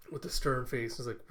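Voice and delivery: ordinary talk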